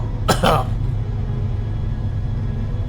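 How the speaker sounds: rough choking cough; hacking cough clearing the throat; coughing up water. Real, not acted. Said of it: "water went down the wrong pipe".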